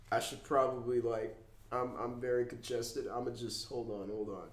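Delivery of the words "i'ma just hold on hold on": normal talking voice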